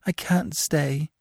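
mild sob. A narrator's reading. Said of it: "she sobbed".

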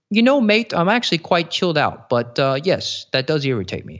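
wobbly mock-Australian accent; terrible Australian accent. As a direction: attempt a chilled Aussie and miss. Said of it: "that was a terrible Australian accent".

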